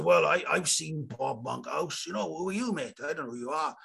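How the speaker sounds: a hint of a Welsh punter scoffing at the comic; dodgy Welsh accent. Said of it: "The audience said".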